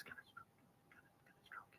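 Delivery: put-on whispered plea of a little girl; child's whisper. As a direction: ask mum in a hushed, eager whisper. Little girl asks mum quietly.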